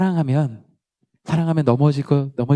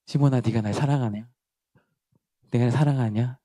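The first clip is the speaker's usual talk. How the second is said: gentle Jesus voice; 3 semitones lower, 6 dB quieter than usual. Retold or imitated. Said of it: "이야기 음성이 막 들리는 거예요".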